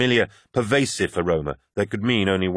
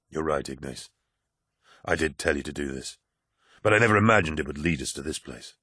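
lower, softer weary admission; the fight gone out of him; weary Loken; 4 semitones lower, 3 dB quieter than usual. Acted. Loken concedes to the poet, low and weary.

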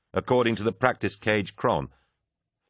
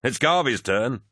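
high, cheery ringside call; lively raised-pitch call; 5 semitones higher, 3 dB louder than usual. A narrator's reading. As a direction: announce the next fighter. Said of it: "Torgadon called".

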